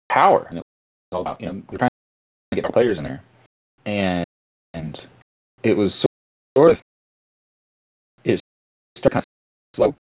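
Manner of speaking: broken-up connection audio; words dropping out mid-phrase; choppy cut off endings. Real, not acted. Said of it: "You're cutting out".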